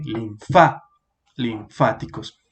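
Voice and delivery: "linfa, linfáticos" with FA punched, lin-FÁ, lin-FÁ-ti-cos; stress on FA. Shown as a demonstration. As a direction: break off after a strong FA, then say "linfáticos" whole. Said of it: "le ponemos el acento ahí". («linfa, linfáticos» marcando FA: linFÁ, linFÁticos)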